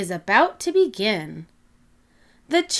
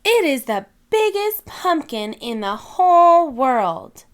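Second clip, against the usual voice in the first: excited; 4 semitones higher, 6 dB louder than usual. Acted. Peppa thrilled by her pumpkin.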